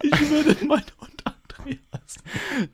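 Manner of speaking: very high, slow, laughing echo; mock the line through high squeals of laughter; laughing mimicry. Mockingly repeats the dog-name line. (lachend und ganz hoch nachgeäfft)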